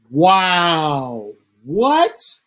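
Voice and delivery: floored by what he just heard; stunned disbelief. Real, not acted. Reacting to the tire story.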